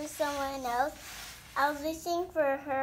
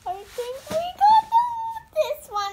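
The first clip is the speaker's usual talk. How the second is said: much higher and louder, rushing out the words; thrilled discovery; 12 semitones higher, 8 dB louder than usual. Real, not acted. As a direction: blurt it out the moment you spot the prize. Kid unboxing finds the doll.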